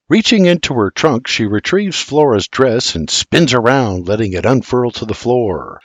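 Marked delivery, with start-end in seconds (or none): none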